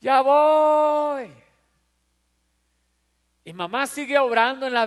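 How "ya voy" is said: loud, high careless "coming" called back; lazy kid reply. Retold or imitated. Son calling back carelessly to his mother. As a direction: brush mom off from the other room. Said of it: "Le entra por un oído y le sale".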